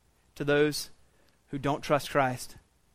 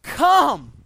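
pleading; 10 semitones higher, 9 dB louder than usual. Retold or imitated. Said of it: "he pleads with him".

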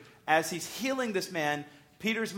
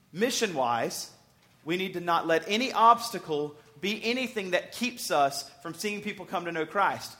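ordinary delivery

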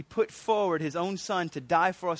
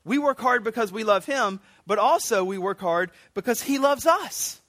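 ordinary talk

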